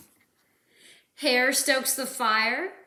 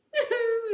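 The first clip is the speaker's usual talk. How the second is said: whimper; 12 semitones higher than usual. Acted. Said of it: "he whimpers".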